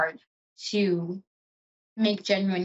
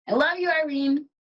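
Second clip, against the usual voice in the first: higher, brighter declaration of love; beaming I love you; gushing affection; 7 semitones higher than usual. Real, not acted. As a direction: lift it up with joy for Irene. Repeats dedication, raised and fuller.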